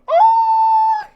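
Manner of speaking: shrieked, very high and loud oh; delighted gasp. Real, not acted. Player squealing as the prince hands her a flower.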